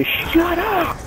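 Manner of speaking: high, slow shut-up snap; snapping. Real, not acted. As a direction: bark it at a teammate. Snapping at teammate.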